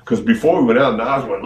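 normal talking voice

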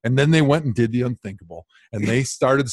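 usual speaking voice

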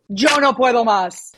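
even, fluent Spanish model; native Spanish accent. Shown as a demonstration. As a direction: model the Spanish sounds plainly. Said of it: "one phrase in Spanish".

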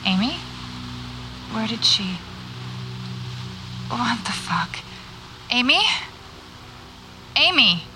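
uneasy calls for a missing friend; searching voice, not yet panicking; calling anxiously. Acted. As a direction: call out, growing worried. Friend vanished, calling for her.

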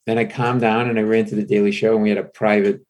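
ordinary talk, nothing marked

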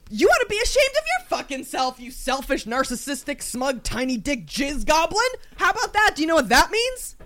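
theatrical fury; raging out. Pretend. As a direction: fling the accusation straight back. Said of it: "raging out in my mind".

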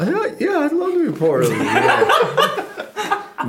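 very high cracking yeah; voice went up high. Retold or imitated. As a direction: squeak it out, choked with joy. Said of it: "I went up in my ro".

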